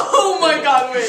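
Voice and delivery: high, loud laughing exclamation. Real, not acted. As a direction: squeal it through laughter. Reacting to the prom invitation.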